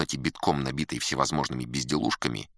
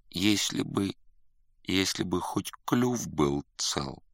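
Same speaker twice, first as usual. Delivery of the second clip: slow, higher plaintive wish; wistful lament; 4 semitones higher, slower than usual. Acted. Dejected student wishing his beak were whole, plaintive. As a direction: lament your misfortune, drawing it out.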